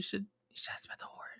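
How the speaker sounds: hushed conspiratorial whisper. Pretend. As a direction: breathe it secretly, no voice. Planned sneaky aside to Viashi.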